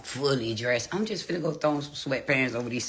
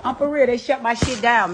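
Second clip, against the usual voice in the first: loud; 11 semitones higher, 8 dB louder than usual. Real, not acted. Loud complaint.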